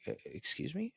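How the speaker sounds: high, incredulous "excuse me" in a retelling; shocked, can't believe what he's hearing; stunned disbelief. Retold or imitated. Repeated in the retold phone call.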